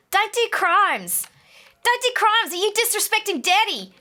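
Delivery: high, slow South African accent impression; Chappy South African accent. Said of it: "shall I try it?".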